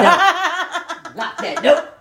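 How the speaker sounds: slow high laughing drawl. Real, not acted. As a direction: drag it out while laughing. Playful repeat.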